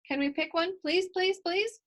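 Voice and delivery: put-on high pleading child's voice rising; begging. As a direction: beg like an eager little girl. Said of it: "I beg".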